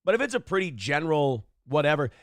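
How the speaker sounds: normal talking voice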